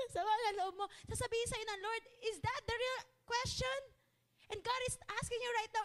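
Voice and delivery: preaching at full force. Acted. Pastor pressing the congregation with God's question, full-force preaching. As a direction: drive it home to the congregation.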